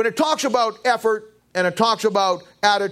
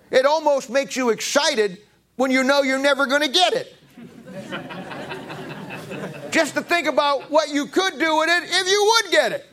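high-pitched daydreaming excitement; playful excitement; 6 semitones higher than usual. Pretend. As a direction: get carried away imagining a huge win. Preacher hams up lottery daydream excitement.

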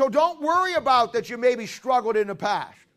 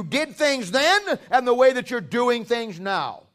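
fiery preaching; 3 semitones higher, 6 dB louder than usual. Acted. Preaching exhortation.

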